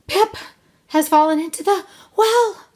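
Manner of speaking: high, loud breathless bursts; winded child straining to finish; gasping. A narrator's reading. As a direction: gasp out the last words urgently. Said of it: "He gasped".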